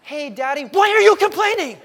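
put-on high, loud kid voice, laughing through it; mock child asking innocently from the back seat; child's voice. Kid in back seat questions complaining dad, high childlike voice.

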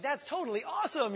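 a hint of a high, excited ad voice; mock commercial. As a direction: lightly imitate the jumping ad kids. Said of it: "that commercial where they're like".

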